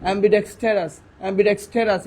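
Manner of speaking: "অ্যাম্বিডেক্সটারাস" repeated as one joined word; unbroken "অ্যাম্বিডেক্সটারাস"; said all together. Shown as a demonstration. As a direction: say "অ্যাম্বিডেক্সটারাস" whole, with no syllable breaks. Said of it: "একসাথে বললে".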